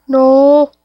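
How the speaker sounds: long loud no breaking into a scream; startled scream. Real, not acted. Player panicking in a scary game, screaming. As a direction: scream no in a sudden fright. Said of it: "I didn't mean to scream".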